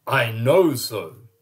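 slow, loud and certain; mayor's voice. Acted. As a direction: state it slowly, firm as a mayor. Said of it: "said the mayor".

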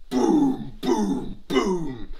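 booming drum hits, first one loudest; imitated drum beats. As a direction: sound out a big war drum. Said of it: "the drums would sound".

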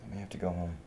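softly, tentatively broken news; subdued admission. Acted. Worried son breaking news to his partner, subdued. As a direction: float it quietly, well under normal volume.

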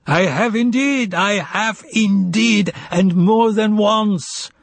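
high, drawn-out gleeful exclamation; hearty, amused exclamation. A narrator's reading. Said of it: "he burst out with a hearty laugh".

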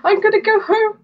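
a bit of an excited French hiker; hiker Emma quoted. Retold or imitated. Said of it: "And she was like".

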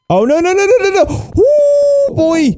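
panicked rising string of noes; terrified gibbering. Real, not acted. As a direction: squeal in panic as death nears. Trap about to kill him.

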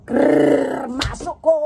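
excited shout. Pretend. Mock football goal excitement.

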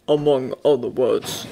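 low, understated bear voice; bear admitting. A narrator's reading. Bear admits there were other words, understated. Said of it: "the bear admitted".

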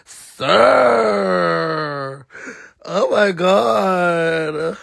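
slow, drawn-out cry of disbelief; overcome, drawn-out moan; scandalized wail. Real, not acted. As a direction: stretch each word into a wail. Overcome by retold remark.

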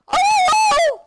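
sudden high loud yelp; jump-scare shriek; real fright cry; startled scream. Real, not acted. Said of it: "I screamed".

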